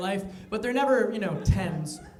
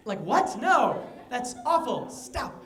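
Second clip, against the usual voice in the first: ordinary talk, nothing marked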